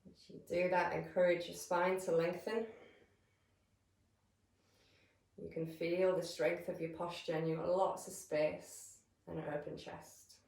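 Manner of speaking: calm, measured meditation voice with long pauses; guided meditation. Real, not acted. Yoga teacher settling listeners into breath awareness, calm guided meditation. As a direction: guide them gently into stillness, leaving space between phrases.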